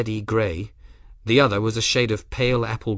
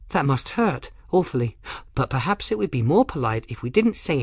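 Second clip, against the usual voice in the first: put-on high, lilting dotty girl; chattering odd girl; 6 semitones higher than usual. Blushing girl talking about Grim as she leaves, high lilting chatter. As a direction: start high and exclaiming, then prattle on with swoops.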